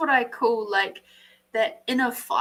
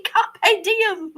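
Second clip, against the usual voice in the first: high, loud, giggling catchphrase; laughing; 10 semitones higher, 5 dB louder than usual. Real, not acted. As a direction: toss it off through real laughter.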